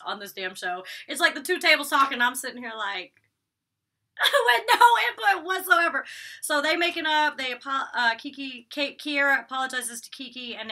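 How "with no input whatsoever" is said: high, loud laughing voice; real laughing. Real, not acted. Vlogger amused by the silent girl at the table, laughing. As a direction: squeeze the words out through laughter.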